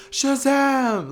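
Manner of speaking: high cheering call; crowd cheer. Retold or imitated. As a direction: shout the hero name like a fan. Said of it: "they would be like".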